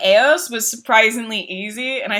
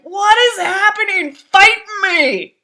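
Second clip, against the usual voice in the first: taunting yell; 10 semitones higher, 5 dB louder, slower than usual. Retold or imitated. Jumping around taunting the boss.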